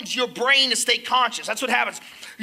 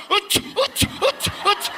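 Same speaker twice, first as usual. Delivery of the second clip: straining grunts; 7 semitones higher, slower than usual. Retold or imitated. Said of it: "And I'm going".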